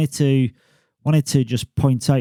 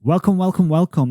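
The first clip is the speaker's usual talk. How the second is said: a lightly put-on Australian greeting, pitched up; a brief playful Australian accent with a high welcome; Aussie accent; 7 semitones higher than usual. Shown as a demonstration. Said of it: "That was my Aussie accent".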